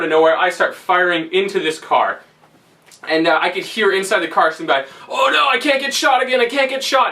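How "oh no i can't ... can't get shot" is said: mock panicked robber. Robber panicking inside the car.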